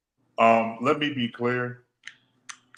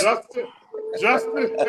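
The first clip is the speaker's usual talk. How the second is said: shocked calling out; 13 semitones higher than usual. Real, not acted. Calling out, laughing reproach.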